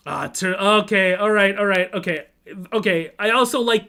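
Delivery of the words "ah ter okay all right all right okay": ordinary talk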